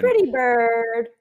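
slow parrot squawk. Retold or imitated. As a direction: draw out pretty bird like a parrot. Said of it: "The gas man".

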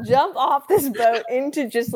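laughing through the words, high and slow. Real, not acted. Bride laughing as she retells jumping off the boat. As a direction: giggle while telling it.

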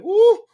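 high, loud "woo!"; re-enacted whoop. Co-host re-enacting the fans' reaction, loud high whoop. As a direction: whoop like a thrilled spectator.

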